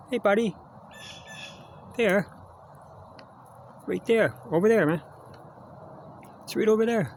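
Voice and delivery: eager high call to a critter; calling to a squirrel. Real, not acted. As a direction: call the little animal over to the treat.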